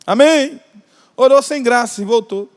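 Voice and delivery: ordinary talk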